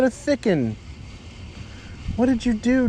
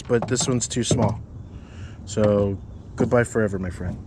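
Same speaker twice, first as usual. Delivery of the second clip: normal talking voice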